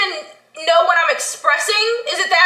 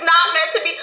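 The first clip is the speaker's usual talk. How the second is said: usual speaking voice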